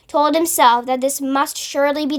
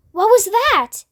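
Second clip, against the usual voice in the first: asked quickly; 7 semitones higher than usual. A narrator's reading. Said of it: "he asked quickly".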